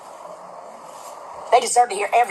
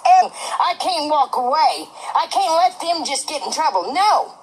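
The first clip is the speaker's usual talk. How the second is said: slow emphatic outrage; 3 semitones higher, slower than usual. Real, not acted. Building outrage over old hag.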